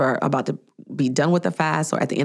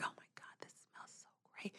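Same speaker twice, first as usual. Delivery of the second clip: hushed, voiceless whisper; barely audible retold swoon; awed whisper; 33 dB quieter, faster than usual. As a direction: whisper it as if overcome by the aroma. Retelling awed reaction to coffee smell.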